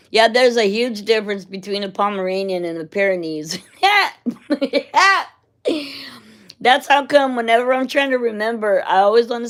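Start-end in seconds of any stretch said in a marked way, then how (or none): 3.8-5.3 a rising laugh that bursts out; high laugh. Real, not acted. Laughing at mixing up Pomeranian and Pyrenees, a high laugh. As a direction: laugh at your own mistake.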